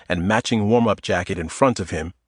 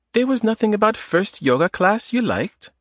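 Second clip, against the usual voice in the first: put-on higher woman's voice with a light Eastern European accent; 11 semitones higher than usual. Adita teasing Tom in the grocery aisle, Eastern European accent. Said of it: "In a light Eastern European accent".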